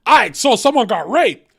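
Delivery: peppy cheerful delivery, absurdly out of place; upbeat energy. Retold or imitated. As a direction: announce it like happy news. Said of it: "with this energy".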